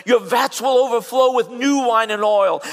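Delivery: high and loud, proclaimed; ringing pulpit promise; preached promise. Acted. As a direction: proclaim it to the crowd. Scripture promise preached aloud.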